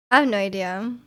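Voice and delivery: normal talking voice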